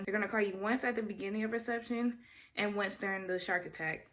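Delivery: usual speaking voice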